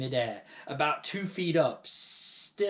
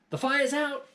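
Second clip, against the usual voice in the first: higher, louder proud announcement; proudly; 5 dB louder than usual. A narrator's reading. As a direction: announce it like a little hero. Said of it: "George announced proudly".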